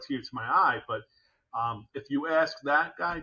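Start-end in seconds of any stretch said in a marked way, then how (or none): none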